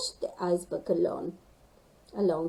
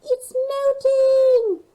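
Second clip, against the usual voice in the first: very high, loud, slow panicked shriek; 12 dB louder, slower than usual. Acted. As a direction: scream it out in alarm as the ice melts. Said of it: "shrieked Gasper".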